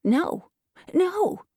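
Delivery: put-on old man's protest, climbing high; high, urgent old-man voice; aged man's cry. A narrator's reading. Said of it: "cried the aged man".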